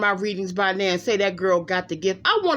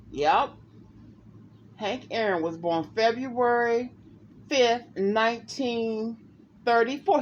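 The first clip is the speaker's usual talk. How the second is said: ordinary delivery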